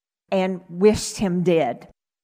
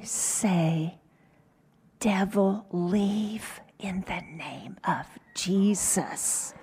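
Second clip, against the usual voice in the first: hushed, softer whisper in the ear; slow secret whisper to his mother; 8 dB quieter, slower than usual. Retold or imitated. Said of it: "He whispered in my ear".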